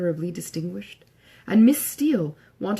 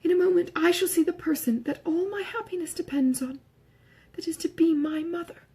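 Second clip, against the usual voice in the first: anxious excitement; 10 semitones higher than usual. Acted. Lucy's anxious aside before meeting.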